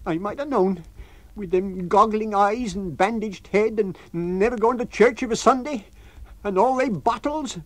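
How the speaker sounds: shrill fear of the lodger; Mrs Hall, panicked landlady. Acted. Landlady begging her husband to keep him out.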